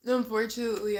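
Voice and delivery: normal talking voice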